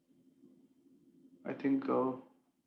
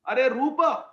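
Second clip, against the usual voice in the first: calling out, louder; 7 semitones higher, 6 dB louder, slower than usual. Acted. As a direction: exclaim the name loudly. Sanatan calling Rupa.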